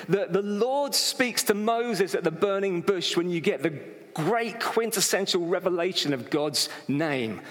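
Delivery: ordinary delivery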